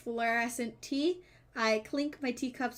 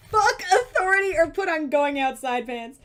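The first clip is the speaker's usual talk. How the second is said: louder, declaiming the choice; mock rallying cry; 4 semitones higher, 8 dB louder than usual. Player declaring the dilemma as a slogan, loud. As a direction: proclaim it like a slogan.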